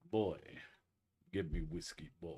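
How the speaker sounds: low, quiet game-character impression with pauses; Kratos. Host playing Kratos ordering whiskey, low impression. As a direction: softly command in a deep imitated warrior voice. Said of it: "that is a hell of a voice".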